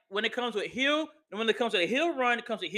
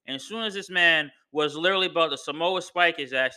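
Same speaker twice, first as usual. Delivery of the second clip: ordinary delivery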